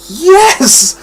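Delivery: high, cheering yes of delight; excited yes. Retold or imitated. Fan reliving his reaction to the twist, cheering yes. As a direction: cheer it like a fan's little victory. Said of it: "And I'm like".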